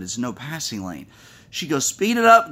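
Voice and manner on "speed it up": loud, high road-rage shout; impatient driver bellowing out the window; mom yelling. Retold or imitated. Mom yells at slow driver.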